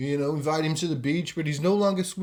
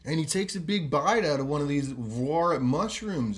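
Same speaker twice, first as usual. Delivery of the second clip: ordinary talk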